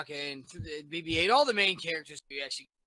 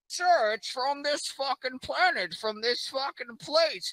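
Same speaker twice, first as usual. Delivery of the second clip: slow, higher, stiff underling voice; officer's report; 10 semitones higher, 3 dB louder, slower than usual. Acted. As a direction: report it to the boss slowly and formally. Officer reports to Kylo Ren.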